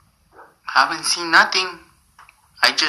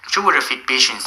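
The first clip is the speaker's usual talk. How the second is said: usual speaking voice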